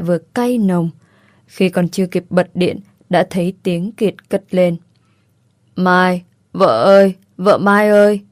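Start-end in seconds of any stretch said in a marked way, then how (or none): 5.8-8.2 tipsy whiny husband. A narrator's reading. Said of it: "giọng xến sẩm, nhõng nhẽo".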